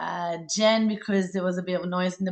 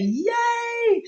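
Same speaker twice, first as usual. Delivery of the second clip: a very high squeal of joy; delighted yay; excited cheer; 13 semitones higher than usual. Real, not acted. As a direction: cheer for her like great news. Cheering a new workshop attendee.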